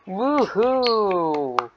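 high sarcastic woohoo; mock cheer. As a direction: cheer it ironically. Cheer for podcast topic.

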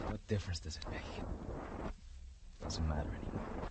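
whispered in despair; defeated whisper. Acted. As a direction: breathe it out, beaten.

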